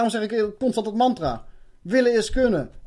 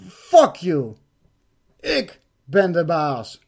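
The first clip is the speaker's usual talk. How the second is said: loud, slow, with a booming timbre; the speaker shouts down the octopus entity, loud and slow; defiant shout; 5 dB louder, much slower than usual. Retold or imitated. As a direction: blast back your authority. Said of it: "riep van".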